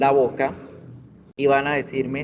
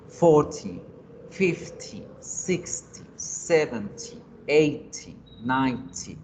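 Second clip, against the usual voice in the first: each number hit on its first syllable, as in "Forty"; first-syllable stress; slower than usual. Shown as a demonstration. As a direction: punch the start and drop the -ty, as in "fifty", "sixty". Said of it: "va con el acento o la fuerza en la primera sílaba". (fuerza en la primera sílaba, como "ninety")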